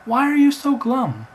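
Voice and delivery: high, loud, pushy bird voice; talking bird. Acted. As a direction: pipe up loudly as a cheeky bird. Talking bird calls to Roger.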